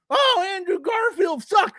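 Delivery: high, slow nerdy whine, shouted; mocking geeky guy. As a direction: lampoon the know-it-all fan loudly. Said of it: "here comes fucking a geeky guy".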